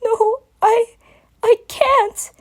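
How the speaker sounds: put-on high, slow, scared child voice; panicked little dragon; frightened pleading. A narrator's reading. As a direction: beg to get away. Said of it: "in a worried tone".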